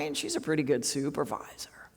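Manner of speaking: hushed, taking the supervisor's side; confiding whisper. Shown as a demonstration. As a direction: whisper it like a secret between colleagues. Whispered aside in the quote.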